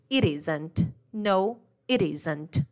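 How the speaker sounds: "It isn't" with a tapped R sound for the T; relaxed American flap; flapped T. Shown as a demonstration. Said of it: "Fica mais ou menos assim".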